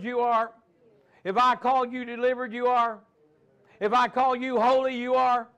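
God commanding his son to sit; hint of a booming God.

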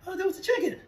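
high-pitched sheepish 'oh'; mock realization. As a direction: play confused, pitch jumping right up. Feigns confusion after wrong animal.

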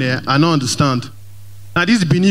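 normal talking voice

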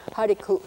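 "hariku" ending in a catch in the throat; final glottal stop. Shown as a demonstration. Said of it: "a little catch in your throat at the end".

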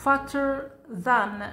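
slow teacher demo; slow model reading. Shown as a demonstration. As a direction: stretch it so the doubled t is heard. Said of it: "لاحظوا التي الأخيرة ضعفناها".

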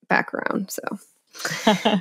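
breathy whispered aside; whispering. Real, not acted. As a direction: drop to a whisper. Trailing aside to host.

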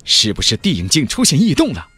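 usual speaking voice

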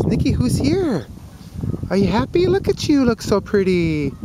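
high doting voice to the dog; pet talk. Real, not acted. Man fussing over his wet dog Nikki, high doting pet talk.

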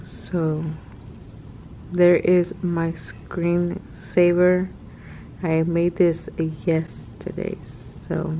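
normal talking voice

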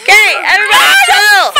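calling out. Real, not acted. Mom calming rowdy kids.

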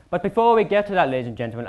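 ordinary talk

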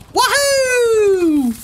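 high loud wahoo; gleeful whoop. A narrator's reading. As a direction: whoop with joy. Duck cheering while driving.

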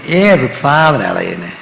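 slow, drawn-out mock indignation; understated annoyance, stretched out; retold irritation. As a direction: grumble it as a retold inner reaction. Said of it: "എനിക്കങ്ങ് ദേഷ്യം തോന്നി".

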